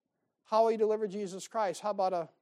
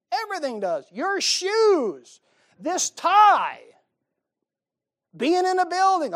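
mock exasperated; 9 semitones higher, 10 dB louder, slower than usual. Real, not acted. Escalating mocking list.

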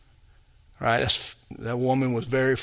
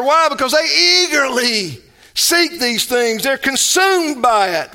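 loud-hearted, raised-pitch pulpit exhortation; forceful preaching; 10 semitones higher, 5 dB louder than usual. Real, not acted. Pastor admonishing believers to quit living like unbelievers, forceful preaching. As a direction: preach it hard, driving each point home.

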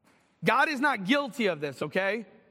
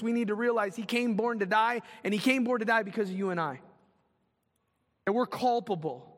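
usual speaking voice

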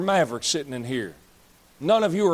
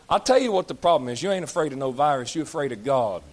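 ordinary delivery

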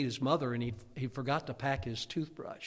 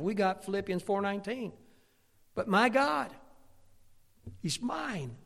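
raised, emphatic pulpit delivery; fervent preaching; 7 semitones higher, 8 dB louder than usual. Acted. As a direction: preach it high, punching key words loud. Preaching at full force.

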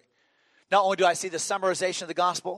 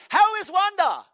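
very high, loud, eager question; Brother Vic; 8 dB louder than usual. Retold or imitated. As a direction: imitate an effervescent pastor shouting his question. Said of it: "he talked like that".

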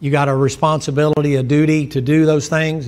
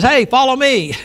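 raised, louder call of Jesus; 8 semitones higher, 5 dB louder than usual. Acted. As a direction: call him to follow, higher and louder. Jesus calls the disciple.

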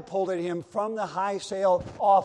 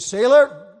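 calling out; 6 semitones higher, 12 dB louder than usual. Acted. Captain calling to sailor.

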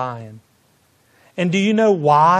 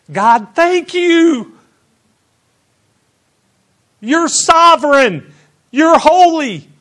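reenacted praise; 12 semitones higher, 10 dB louder than usual. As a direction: shout your thanks to God again. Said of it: "I was like".

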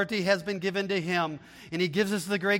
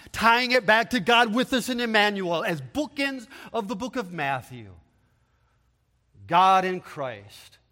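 usual speaking voice

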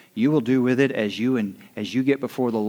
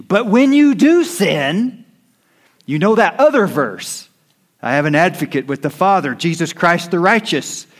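fast, much higher and louder, driving the verses home; preaching at full force; 7 semitones higher, 6 dB louder than usual. Acted. Preacher pressing scripture on the congregation, full-force fervent preaching. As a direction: preach it out like a revival altar call.

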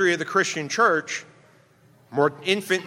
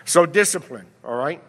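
usual speaking voice

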